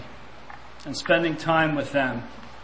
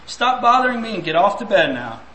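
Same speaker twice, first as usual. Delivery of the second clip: irritated order; 6 semitones higher, 5 dB louder than usual. Retold or imitated. Father ordering son to bed.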